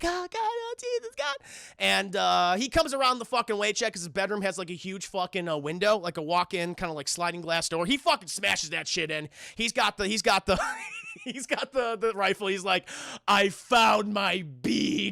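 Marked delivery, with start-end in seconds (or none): 0.0-1.4 very high, breathy panic; mock terrified gasp. Terrified victim. 13.3-15.1 creepy villain line. Retold or imitated. Villain announcing, slow.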